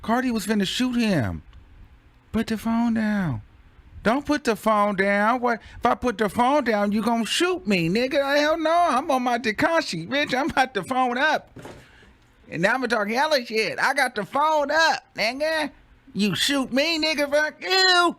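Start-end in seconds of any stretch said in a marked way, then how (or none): 2.3-3.4 high, even mimicked order; very calm. Imitating the rapper ordering a phone down, calm. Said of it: "It was very calm, like". 4.0-18.2 high-pitched, animated back-talk; mock defiance. Playing the man refusing to put the phone down, defiant.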